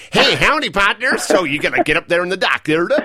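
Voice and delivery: hammed-up cowboy American accent; mock American accent with a howdy-partner swagger. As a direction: play a folksy American cowboy, badly tuned in. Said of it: "How's the American accent coming?".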